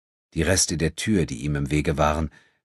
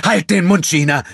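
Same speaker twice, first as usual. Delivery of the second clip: cutting her off; 13 semitones higher, 10 dB louder than usual. A narrator's reading. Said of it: "unterbrach Enrico sie".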